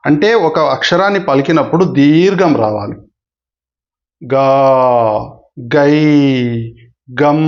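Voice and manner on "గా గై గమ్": గా, గై, గమ్ each drawn out long; long syllables. Shown as a demonstration. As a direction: stretch గా, గై, గమ్ over two beats. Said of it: "ఎక్కువ సమయం పలకాలి".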